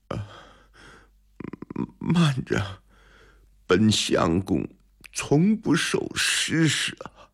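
faint scholar forcing words out; feeble. A narrator's reading. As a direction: speak as if drained of all strength. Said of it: "有气无力地说".

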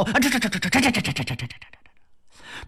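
fleeing wife stammers in panic; panicked stammer. Acted. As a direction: stammer fading to breath.

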